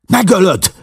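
high desperate shout; a sharp cry breaking high. A narrator's reading. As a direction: yell it to stop the killing. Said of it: "kiáltott rá".

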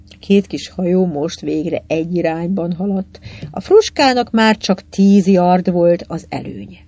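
ordinary delivery, nothing marked

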